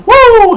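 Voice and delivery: a high, loud Ric Flair woo; high woo yell. Retold or imitated. Imitating Charlotte's woo cry to the crowd, loud and high. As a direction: belt out a shrill woo. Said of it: "And she's like".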